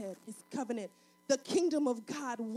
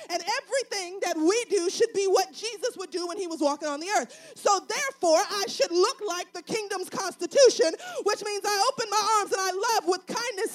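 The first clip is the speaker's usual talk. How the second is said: raised, driving sermon climax; full-force preaching; 5 semitones higher, 7 dB louder than usual. Real, not acted. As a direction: build through the list, then ease down at the close.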